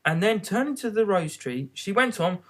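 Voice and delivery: plain reading voice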